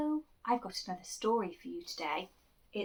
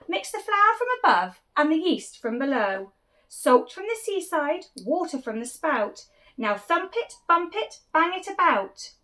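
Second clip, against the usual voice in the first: louder, bossy baker's orders; giving instructions; 10 semitones higher, 14 dB louder, slower than usual. Acted. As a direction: call out the recipe like a cook in charge. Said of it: "Bap gave instructions".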